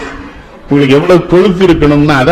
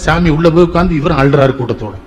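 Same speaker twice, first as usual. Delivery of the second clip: ordinary talk, nothing marked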